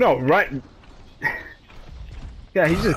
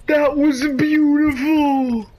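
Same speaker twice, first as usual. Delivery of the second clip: high, drawn-out wail of awe; blissed-out high cry; overjoyed wail; 7 semitones higher, slower than usual. Real, not acted. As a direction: stretch it out, almost crying with delight. Overcome by a great play.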